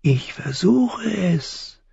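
high, drawn-out crow voice; high, thin voice. A narrator's reading. Crow answers falling boy's plea, high and thin. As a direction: stretch it slowly in a thin high voice. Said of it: "Die Stimme klang hoch und dünn".

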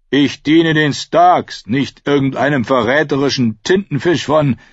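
bloodied smith shouting defiance; defiant Micken. Acted. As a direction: spit out your loyalty loudly. Micken defies Theon.

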